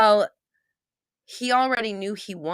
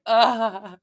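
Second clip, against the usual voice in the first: higher mock groan; imitated overwhelmed groan; 6 semitones higher, 4 dB louder than usual. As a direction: mimic collapsing against a wall. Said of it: "falls against the wall".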